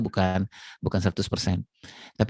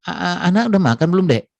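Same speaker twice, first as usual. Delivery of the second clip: hurried husband; 7 semitones higher, 4 dB louder than usual. Retold or imitated. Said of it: "Ya, ya, ya".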